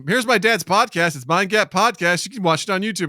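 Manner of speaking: lightly voiced child's sales pitch; imitated proud daughter. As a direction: announce it like a child promoting dad. Voicing daughter proudly plugging podcast.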